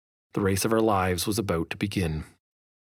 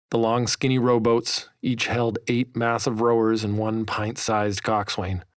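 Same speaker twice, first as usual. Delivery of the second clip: plain reading voice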